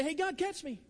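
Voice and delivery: a hint of a little boy calling out; eager childlike call. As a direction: call up like a child leaping into a father's arms. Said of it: "and say".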